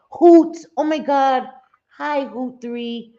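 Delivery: high, loud delighted call of a name; excited call. Real, not acted. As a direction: call out the name happily, like spotting a friend. Calling out a viewer's name.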